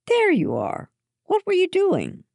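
put-on high, pressing question to a child; parent's exclamation. A narrator's reading. Parents joining the girls after the chase, exclaiming and questioning.

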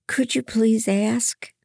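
high, pleading little voice; begging. A narrator's reading. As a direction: plead for the favour. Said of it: "Louisa begged".

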